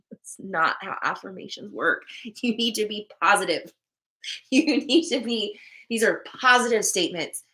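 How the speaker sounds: ordinary talk, nothing marked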